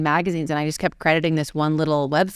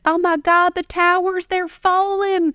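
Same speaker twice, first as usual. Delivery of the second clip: much higher, louder Southern cry of alarm, drawn out at the end; panicked Southern accent; 4 dB louder, slower than usual. Retold or imitated. A woman bursts into the seminar, panicked Southern accent. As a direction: imitate a frantic Southern woman. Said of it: "woman with a very Southern accent".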